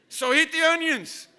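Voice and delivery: loud commanding order; army dad ordering his son to eat; stern army father. Retold or imitated. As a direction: bark it like a general. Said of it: "he's kinda like a general".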